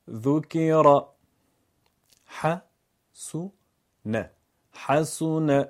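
slow syllable-by-syllable reading, then the whole word; teacher splitting Quran words into syllables; syllable drill. Shown as a demonstration.

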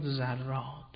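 "زراد" drawn out slowly with an opening z; slow demonstration of z in "زراد"; s turned to z. Shown as a demonstration. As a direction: begin "زراد" on a voiced z, slowly. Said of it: "حالا این سین گاه به ز".

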